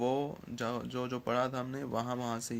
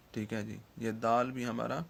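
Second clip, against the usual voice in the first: ordinary delivery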